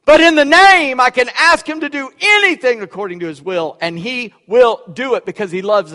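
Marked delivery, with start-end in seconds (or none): 0.0-2.8 name shouted high and loud; loud preaching. Real, not acted. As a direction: proclaim it with full force. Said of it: "preaching climax".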